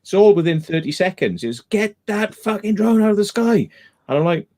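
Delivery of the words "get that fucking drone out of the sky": high-pitched mock yell, held back in volume; imitated megaphone shout. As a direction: imitate an irate shouter on a megaphone, without full force. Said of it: "shouts got louder and then a megaphone".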